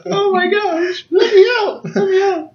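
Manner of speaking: high-pitched, hammed-up wail of panic; mock panicked kid. Teasing friend as his seventh-grade self locked up, mock panicked wail. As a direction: play a squealing little prisoner begging for release.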